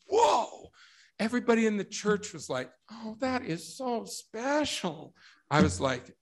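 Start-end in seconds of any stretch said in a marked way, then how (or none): 0.1-0.7 very high, loud whoa; retold alarm. Guest freaking out at the sealing words, alarmed. 2.9-5.1 high, slow mock sweetness; gushing congregation impression. Wedding guests moved by the vows, mock gushing.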